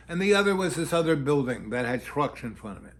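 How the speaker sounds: usual speaking voice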